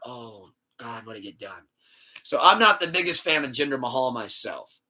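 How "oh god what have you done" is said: very soft, dismayed oh God; dismayed murmur. Retold or imitated. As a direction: say it under your breath, dismayed. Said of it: "and that's when I went".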